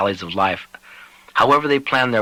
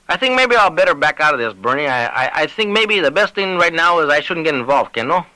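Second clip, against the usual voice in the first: higher, stammering retreat; nervous Lavadier; 6 semitones higher than usual. Acted. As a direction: back out of it sheepishly. Lavadier voiced, backing out nervously.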